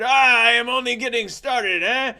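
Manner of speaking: high, loud comic French bravado; exaggerated, booming French hero; Jacques de Gatineau. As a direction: boast it big in a put-on high voice. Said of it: "that's how he talked".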